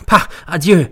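high, loud dismissive huff; contemptuous goodbye; scornful farewell. A narrator's reading. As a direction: brush him off. Nelly's dismissive exit.

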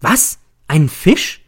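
high, loud cry of disbelief; startled shout. A narrator's reading. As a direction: jump up and cry out. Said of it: "Ich schnelle empor und schreie".

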